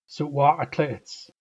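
Suwa'atla'its drawn out very slowly, each syllable separate; slow model pronunciation. Shown as a demonstration. Teacher slowly modelling Suwa'atla'its for learners. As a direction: spell Suwa'atla'its out with the voice. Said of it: "Let's build this one".

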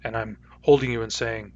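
normal talking voice

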